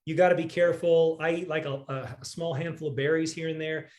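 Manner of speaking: ordinary talk, nothing marked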